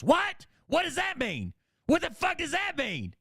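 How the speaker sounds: high-pitched, escalating mock outrage; outraged demand. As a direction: act out an offended mother exploding. Mom outraged at son's insult.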